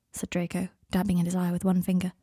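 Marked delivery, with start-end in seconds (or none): none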